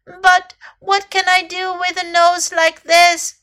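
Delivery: high, loud, mildly plaintive question; crying out. A narrator's reading. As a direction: complain like an upset little elephant. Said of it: "cried Little Elephant".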